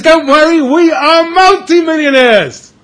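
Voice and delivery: high, loud and slow, bursting with pride; proud announcement. Acted. Husband boasting to his wife about his riches, proud and loud. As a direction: announce the fortune triumphantly.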